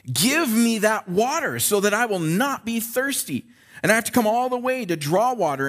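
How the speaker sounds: lightly voiced eager request; eager Samaritan woman. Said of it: "So she says".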